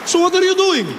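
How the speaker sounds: high-pitched exasperated character voice; objecting passenger. Acted. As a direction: point out the obvious, high and insistent. Acts unimpressed fellow passenger.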